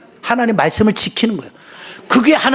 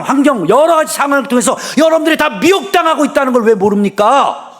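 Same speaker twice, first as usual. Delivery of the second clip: rebuking shout; 8 semitones higher than usual. Real, not acted. Pastor rebuking the church.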